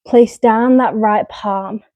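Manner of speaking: ordinary talk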